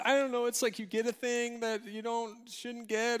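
high, unsure guessing voice; flustered pupil. Retold or imitated.

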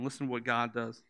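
ordinary delivery

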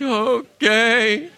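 meek okay. Retold or imitated. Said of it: "I'm like".

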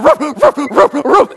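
high, rapid imitated barks; excited dog woofing over and over; dog barking. Owner imitates his riled-up dog, excited barking. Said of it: "And he's like".

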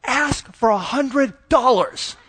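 high, slow, giggly kid voice imitated; mock a four-year-old hissing a tip; little girl's loud whisper. Dad imitating his four-year-old coaxing her sister, high and slow. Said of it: "trying to whisper so I don't hear".

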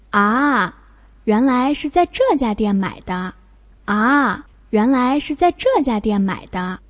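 long dipping "ah" of realization; understanding. Shown as a demonstration. Teacher reading quiz sentence for student, sudden realization. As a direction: sound as if it just became clear. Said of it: "here shows you understand something".

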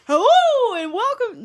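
big booming announcer hello. Retold or imitated. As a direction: boom it out like a radio host.

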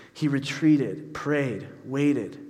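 usual speaking voice

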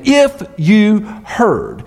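slow, weighty "If you heard", stress on if; emphatic statement. Shown as a demonstration. Preacher to church, quoting Paul's emphatic opening, slow and weighty. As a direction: lean on "If" and draw the phrase out slowly. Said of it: "this emphatic statement".

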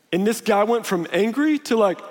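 normal talking voice

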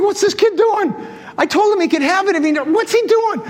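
high, exasperated inner protest; acted-out bafflement; indignant disbelief.

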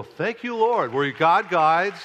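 loud, drawn-out praise, starting high; exultant thanks. Retold or imitated. Pastor recalls thanking God for the check, exultant praise. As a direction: re-enact an exultant shout to God. Retells grateful reaction to huge check.

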